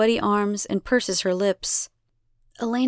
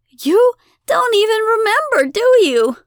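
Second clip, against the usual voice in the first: high, loud, appalled accusation; incredulous outrage; 13 semitones higher, 8 dB louder than usual. A narrator's reading. Abigail gapes at the forgetful goddess, loudly incredulous. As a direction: accuse her in disbelief.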